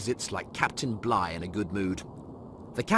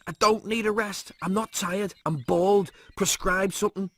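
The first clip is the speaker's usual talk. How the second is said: higher, pushy protest; Lister insisting; 9 semitones higher, 3 dB louder than usual. Acted. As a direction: demand a cure, exasperated.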